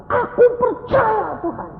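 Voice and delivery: imitated high, slow, loud wail; childlike crying. Woman crying out her belief to God, imitated childlike wail. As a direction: mimic a woman wailing like a child. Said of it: "Nangis kayak anak kecil".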